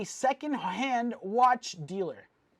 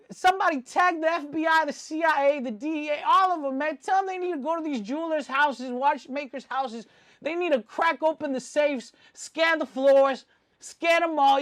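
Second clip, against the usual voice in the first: raised, louder voice urging a raid; mock alarm; 5 semitones higher, 5 dB louder than usual. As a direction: call out high and forceful, rallying the authorities. Mock alarm calling for the feds.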